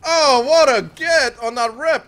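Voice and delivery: loud, high, drawn-out cheer; thrilled shout. Real, not acted. Player cheering a great grab, excited shout. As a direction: crow slowly and loudly over the play.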